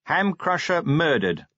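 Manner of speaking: deliberate reading aloud; reading a headline. A narrator's reading. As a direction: read the headline out slowly. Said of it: "Vimes read".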